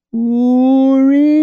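high, drawn-out wailing cry; loud call. A narrator's reading. Tanny calling from his cot, loud wail. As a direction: sing out loudly, very high and long. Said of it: "Tanny said loudly".

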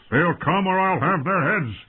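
high, forceful angry shout; roared threat. A narrator's reading. Knight threatening the midwives' lives, roared. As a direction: threaten them at full urgency. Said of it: "Ser Jorah roared".

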